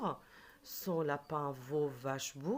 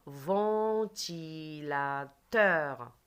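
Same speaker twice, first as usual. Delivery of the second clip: "Ventilateur" extremely slow, each syllable held long; "Ventilateur" in four long syllables for pupils to hear; stretched syllables; 4 dB louder, much slower than usual. Shown as a demonstration. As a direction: draw out "Ventilateur" beat by beat. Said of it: "Répète après moi".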